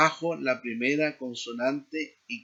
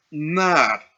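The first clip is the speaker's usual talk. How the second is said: "Nar" punched loudly on its first vowel; first-syllable stress; 7 dB louder than usual. Shown as a demonstration. Teacher giving a last example, first-syllable stress on Nar. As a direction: hit the start of "Nar" hard. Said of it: "el énfasis en la primera sílaba".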